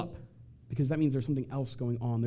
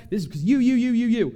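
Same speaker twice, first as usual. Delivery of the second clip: louder, rising jabs on each "you"; mock yell; 7 semitones higher, 6 dB louder than usual. Screamer at the family table yelling out the problem, louder. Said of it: "you yell it out".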